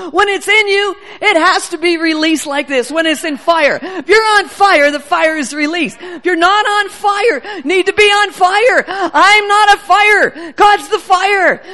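loud, charged preaching with a cry in the voice; fiery preaching. Real, not acted. Preacher rousing the church about fire, loud and tearful. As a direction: preach it hard, voice breaking.

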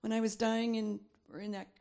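ordinary delivery

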